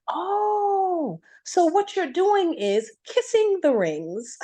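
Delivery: imitating a European accent. Host boss teasing her about kissing the rings, in a mock European accent. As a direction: mimic her Europe-born boss's accent with a teasing lilt. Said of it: "I wish I could do his accent".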